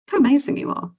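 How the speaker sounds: high, bright praise for yourself; caring coach voice. Retold or imitated. Coach praising a client after all she achieved, lifted caring voice. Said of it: "Caring coach is".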